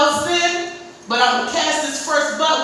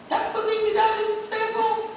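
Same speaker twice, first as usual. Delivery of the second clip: pitched-up disbelief; higher surprised question; 8 semitones higher, 3 dB quieter than usual. Retold or imitated. Mother hearing what the son says it means, high shocked question. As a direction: cannot believe what it means.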